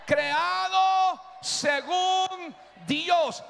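high, very drawn-out preacher's cry; shouted declaration. Real, not acted. Preacher leading the women's response, shouting. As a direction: stretch it into a long shout. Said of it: "La mujer grite".

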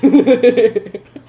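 loud high giggling laugh; high laugh burst. Real, not acted. Laughing at friend's mic.

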